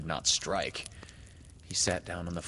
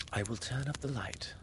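soft, near-whispered statement; hushed; 2 semitones higher, 5 dB quieter than usual. A narrator's reading. Wendy tells frightened Peter about the light, hushed.

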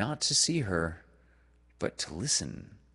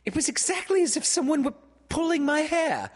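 put-on very high, louder girlish screech; screeched complaint; 5 dB louder, faster than usual. A narrator's reading. Wendy complaining her hair was pulled, high screech. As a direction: screech it in outraged pain. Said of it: "almost immediately she screeched".

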